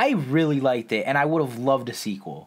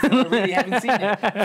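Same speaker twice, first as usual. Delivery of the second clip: laughing; 10 semitones higher, 4 dB louder than usual. Real, not acted. Laughing admission among friends.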